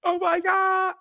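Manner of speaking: high squealed oh my God; sudden high shocked exclamation; shocked gasp. Real, not acted. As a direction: shriek it in disbelief. Shocked reaction to heart attack.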